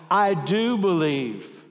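a sudden loud cry, slowing on I do believe; father's exclamation. Acted. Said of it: "the boy's father exclaimed".

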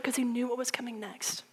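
hushed whisper. Real, not acted. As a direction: breathe it like a secret. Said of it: "whispered aside".

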